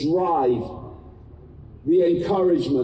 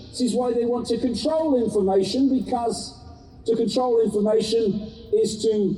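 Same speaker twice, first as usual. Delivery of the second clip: urgent activist; 4 semitones higher than usual. Acted. Impassioned speech to crowd.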